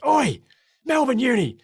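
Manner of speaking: imitating an official's loud, high-pitched bellow; angry yell. Official ordering a runner off the blocks, yelling. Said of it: "like, yelled at me".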